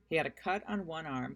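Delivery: ordinary delivery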